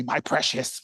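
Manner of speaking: low, soft, mock-creepy hiss of a hoarder; Gollum impression. Senator clutching his fries, lightly mimicking Gollum, softly. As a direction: clutch the words like a greedy little creature. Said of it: "like that guy from that Lord of the Rings movie".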